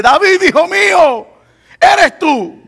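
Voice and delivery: high-pitched retold royal call; King Saul calling. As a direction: imitate a king calling out to his son from afar. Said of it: "escucha la voz del rey que le dice".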